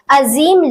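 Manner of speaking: "अजीम" as "azeem", with z; correct z sound. Shown as a demonstration. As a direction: say "अजीम" with a buzzing z. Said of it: "अजीम लफ्ज पढ़ा जाएगा". ("अजीम" को "ज़" से, सही)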